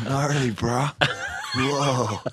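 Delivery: high, drawn-out surfer bro; awed surfer dude going whoa. Pretend. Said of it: "shapeshifted into a surfer dude".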